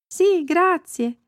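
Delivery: put-on high, slow little girl voice; drawn-out polite child's reply; Annika. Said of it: "disse Annika". (voce acuta e lenta da bambina)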